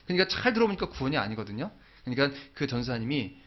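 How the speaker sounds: normal talking voice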